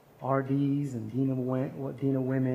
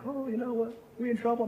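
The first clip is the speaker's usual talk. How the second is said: put-on worried staff girls; 10 semitones higher than usual.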